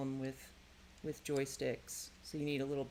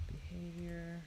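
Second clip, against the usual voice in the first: soft, drawn-out monotone; slow, quiet, stretched-out words; absent-minded murmur; 8 dB quieter, much slower than usual. Real, not acted. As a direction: murmur it slowly to yourself while busy. Murmuring words while working.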